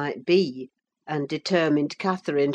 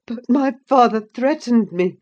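gasped; 7 semitones higher, 7 dB louder than usual. A narrator's reading. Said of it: "gasped the boy".

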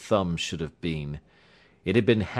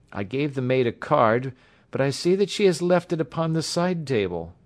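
put-on higher-pitched voice of the young engineer; agitated young patient; 6 semitones higher than usual. A narrator's reading. Injured man apologizing to the doctor for knocking him up, agitated.

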